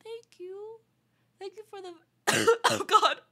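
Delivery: high squeaky voice from a sick throat; duck-like voice. Real, not acted. Streamer sick with covid thanking chat, duck-like voice. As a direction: sound like a duck while thanking. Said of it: "I sound like a fucking duck".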